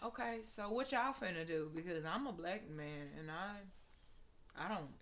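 quiet, hesitant retold objection; imitating a driver's objection. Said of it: "who was just like".

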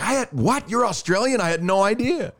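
high-pitched mock disbelief; amazed double take, imitating himself; retold shock. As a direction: act stunned, voice jumping way up. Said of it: "And I was like".

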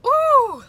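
high, loud ooh of shock; surprised ooh. Real, not acted. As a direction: yelp at the taste.

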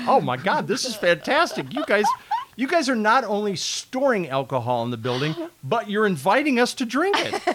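high, loud gushing delight; excited retelling. Retold or imitated. Coworker retelling his thrilled reaction, high and loud. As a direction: gush over the stash like it's a gift.

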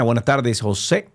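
ordinary talk, nothing marked

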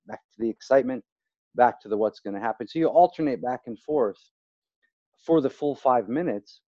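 normal talking voice

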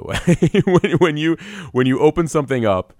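bursting out laughing mid-sentence; laughing out loud while agreeing; real laughter. Real, not acted.

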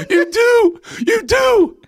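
very high, loud Jackson voice; [name] impression. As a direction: squeal it very high. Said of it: "I was [name]".

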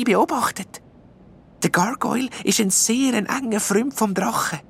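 lightly voiced elf, earnest; little elf explaining. A narrator's reading.

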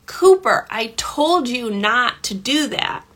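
demonstrated exasperated telling-off; frustrated parent escalating. Shown as a demonstration. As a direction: lay down the limit with rising frustration. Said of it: "my volume keeps increasing".

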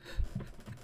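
short, quiet breathy chuckle; soft real chuckle; amused laugh. Real, not acted. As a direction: let out a small amused laugh. Laughs at the comic's grand titles.

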